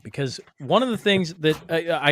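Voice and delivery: usual speaking voice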